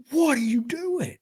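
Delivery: high, loud, drawn-out demand; retold outraged yell. Bitten store worker confronts woman, mock outraged yell. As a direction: act out yelling at someone who just bit you.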